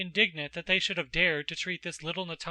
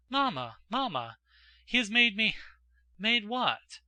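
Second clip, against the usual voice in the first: put-on breathless girl bursting out with news, higher and louder; excited exclamation; 5 semitones higher, 5 dB louder than usual. A narrator's reading. Natasha runs to her mother with news: excited. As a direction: blurt it out in a rush of excitement. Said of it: "she exclaimed".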